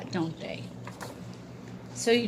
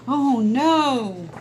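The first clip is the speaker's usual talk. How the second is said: alarmed; 4 semitones higher, 7 dB louder than usual. Acted. Hawk attacks.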